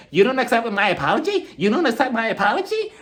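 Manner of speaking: high-pitched imitation of affronted questioning; mock outrage, rising into each question; indignant disbelief. Acting out Teresa's indignant questions.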